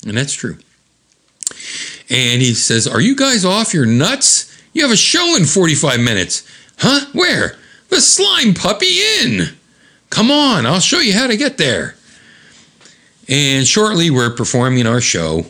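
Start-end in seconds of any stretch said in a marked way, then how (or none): 2.9-6.4 incredulous manager. Retold or imitated. Retold manager scolding the band. 6.8-7.6 confused. Retold or imitated. Band reacts, confused. 7.9-12.0 squeaky high voice. Retold or imitated. Manager voice, very high.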